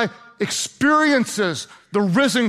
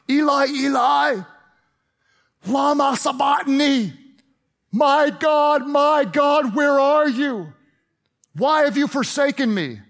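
high, sustained shout to heaven; desperate cry; 5 semitones higher, slower than usual. Acted. Jesus on the cross crying out to God, desperate. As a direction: cry out in anguish with long, ringing words. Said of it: "in his most desperate moment and cries out".

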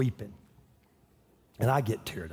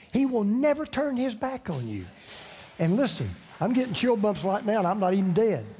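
higher, slow, rising sermon climax; a pastor lifting the Christmas congregation, preaching at full force; 7 semitones higher than usual. Acted. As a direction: build it like a preacher's peak.

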